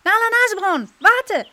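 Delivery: high, loud squirrel call; calling again. A narrator's reading. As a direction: shout her name from nearby. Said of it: "Dann rief es wieder".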